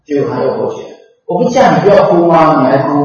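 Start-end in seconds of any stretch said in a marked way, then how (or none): 1.3-2.9 loud exasperated rebuke; loud scolding. Retold or imitated. Revived father scolds his weeping children, loud and exasperated. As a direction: bark at them for still crying.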